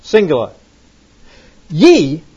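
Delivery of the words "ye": "Ye" said loud and separate; stress on ye. Shown as a demonstration. Teacher marks plural "Ye" for students, stressing it. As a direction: punch "Ye" on its own.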